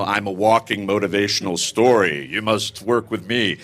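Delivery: low, mock self-help guru voice; boastful guru. Guest mocking a salesy guru pitch, low mock voice. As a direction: parody a pushy motivational speaker. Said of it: "I don't wanna sit here and go".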